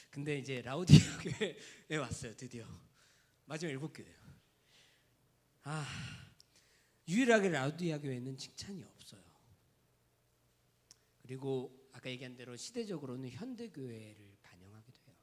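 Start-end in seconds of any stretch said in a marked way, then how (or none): none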